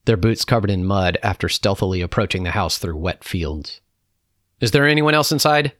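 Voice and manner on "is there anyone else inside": loud, high interrogating question; raised military questioning; soldier's demand. A narrator's reading. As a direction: demand an answer from the household.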